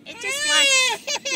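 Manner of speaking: loud, slow wailing; real crying. Real, not acted. Scared baby in the car wash, wailing. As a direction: wail through the words.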